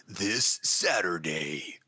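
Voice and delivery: slow, low movie-trailer announcer; deep announcer voice. Shown as a demonstration. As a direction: drop low and stretch each word. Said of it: "deep, announcer voice".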